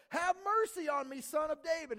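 a high, loud appeal for mercy; crying out. Acted. Blind man begging Jesus for mercy, crying out. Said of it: "he was crying out all the more".

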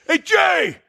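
high-pitched retold holler; yell. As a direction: reenact yelling to catch someone's attention. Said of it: "And I yelled at him".

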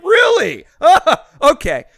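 high laughing "really" in mock surprise; incredulous laugh through the words; laughing disbelief.

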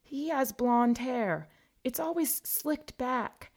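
mournful, a little lost; sad Myrtle. A narrator's reading. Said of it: "Myrtle replied sadly".

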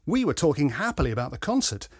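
plain reading voice

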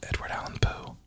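breathy whisper, no voice; whispered aside. Real, not acted. Host whispering a joke name to himself. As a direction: whisper Edward Allan Poe under the breath. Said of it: "whispered aside".

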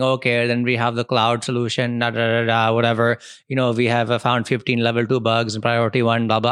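put-on IT guy accent, voice kept low-key; silly IT guy accent. Some guy presenting slides at the office, silly IT guy accent. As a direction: do a silly IT guy accent reeling off project updates. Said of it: "I'm doing that silly I T guy accent".

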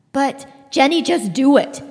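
loud, urgent plea; insisting. Acted. Father urging his wife to take the immunity, loud and insistent. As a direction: press her hard to accept. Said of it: "insisted their father".

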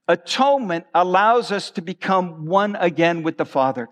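ordinary talk

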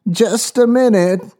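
put-on high, slow old woman's voice; frail elderly voice. A narrator's reading. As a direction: speak as a frail old lady. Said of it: "answered a frail elderly voice".

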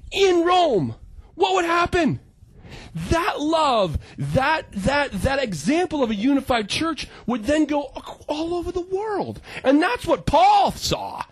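preaching at full force; passionate. Real, not acted. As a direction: build to a fervent peak. Passionate sermon climax.

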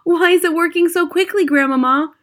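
put-on high, loud, thrilled boy's voice; excited cry. A narrator's reading. Boy watching witches grow fur beside his grandmother, loud excited cry. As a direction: cry it out, pitched right up. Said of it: "I cried".

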